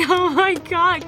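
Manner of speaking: high, laughing disbelief; giddy, high-pitched oh-my-God; retold giddy gasp. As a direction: relive a thrilled fan reaction, pitched well up. Retold thrilled reaction to the book.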